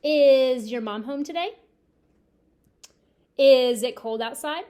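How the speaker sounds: loud, sing-song teacher examples; loud example sentences. Shown as a demonstration. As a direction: call out the sentences to the class. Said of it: "example sentences to class".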